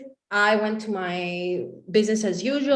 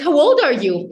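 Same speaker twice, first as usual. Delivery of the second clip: loud, higher-pitched blurted question; eager question; 5 semitones higher, 9 dB louder than usual. Retold or imitated. Woman suddenly asking a young founder's age, eager.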